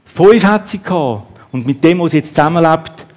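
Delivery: usual speaking voice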